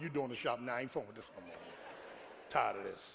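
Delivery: low, soft fed-up muttering; tired grumble. Retold or imitated. Pastor home from the store tells his wife, fed-up mutter. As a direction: grumble it like a beaten man.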